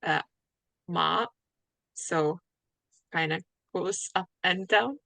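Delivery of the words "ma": ma dipping down then up, third tone. Shown as a demonstration. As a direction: Mandarin third tone ma. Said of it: "And then the third one is".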